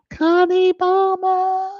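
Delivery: high, long-held shout of a name; voice giving out. Real, not acted. Streamer hailing a viewer by nickname, drawn-out holler giving out. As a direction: holler it out slowly till the voice gives way. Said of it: "my voice always seems to go out at that point".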